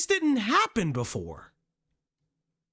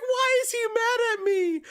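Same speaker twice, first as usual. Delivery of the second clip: mock whiny Kendra; 11 semitones higher than usual. Mimicking Kendra then answering.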